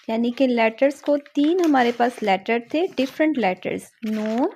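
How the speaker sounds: ordinary talk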